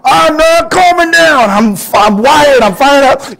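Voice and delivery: high, loud, slow fired-up protest; mock angry retort. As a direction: push back hotly at being told to calm down. Angry person refusing to calm.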